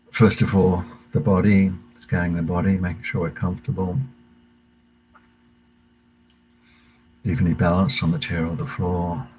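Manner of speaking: calm meditation guide voice, unhurried pauses; guided meditation. Acted. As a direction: lead the class gently into the breath. Leads a breathing meditation.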